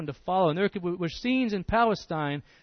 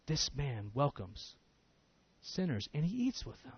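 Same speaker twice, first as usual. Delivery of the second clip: softer, low grumbling mutter; 3 semitones lower, 10 dB quieter than usual. Retold or imitated. Pharisees muttering their criticism of Jesus, soft and low. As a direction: grumble it under your breath. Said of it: "They're muttering".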